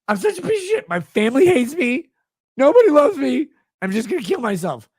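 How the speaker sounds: high sobbing whine; mocking whiny voice. Mocking the fan's text.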